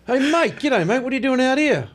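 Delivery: high, hammed-up broad Australian accent; playing a cheery Aussie local in a broad Australian accent. Aussie local greets the visiting actor, broad Australian accent. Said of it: "which is like".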